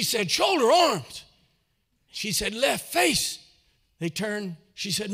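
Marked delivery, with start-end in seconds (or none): 0.3-1.2 high, loud mock military order; drill command. Helmeted woman drills little boys, loud mock order. 2.5-3.4 drill command. Retold or imitated. Estelle gives drill orders.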